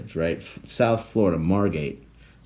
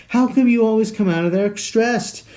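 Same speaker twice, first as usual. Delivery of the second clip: imitating wife; 13 semitones higher, 4 dB louder than usual. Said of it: "My wife's like".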